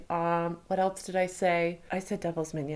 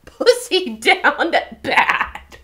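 laughing echo; 9 semitones higher, 11 dB louder, much slower than usual. Real, not acted. Repeating phrase with laugh.

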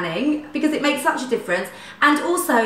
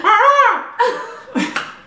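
high shrieking screech; pterodactyl shriek; 11 semitones higher, 3 dB louder than usual. Shown as a demonstration. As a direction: let out a piercing bird screech. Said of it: "Can you do an animal impression?".

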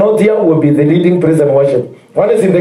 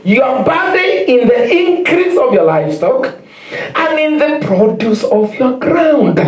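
higher, slow preaching read; fervent scripture reading; 8 semitones higher than usual. Acted. Preacher reads Deuteronomy to the congregation, slow and fervent. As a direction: proclaim each blessing slowly.

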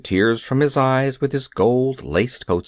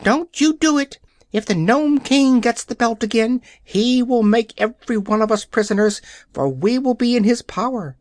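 hen crying out; 9 semitones higher than usual. Acted. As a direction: squawk the warning up high like an anxious hen. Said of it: "but Billina cried out".

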